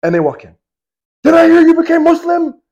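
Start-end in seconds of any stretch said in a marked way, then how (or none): 1.2-2.6 enraged demand. Retold or imitated. Enraged Umar confronts his sister.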